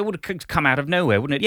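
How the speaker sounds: usual speaking voice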